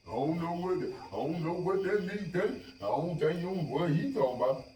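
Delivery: high, soft mock-servile voice; mimicking subservient talk in a high voice; mocking slave talk. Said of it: "they talk like slaves".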